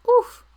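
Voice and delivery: high, loud cartoon oof; Roblox oof. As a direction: blurt it like the game death sound. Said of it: "Do the Roblox oof!".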